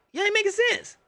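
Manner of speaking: high-pitched disbelief at the logic; exasperated. Real, not acted. Exasperated rant at team logic.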